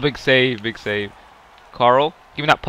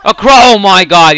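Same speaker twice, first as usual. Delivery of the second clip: excited exclamation; 6 semitones higher, 12 dB louder than usual. Real, not acted. Reaction to play.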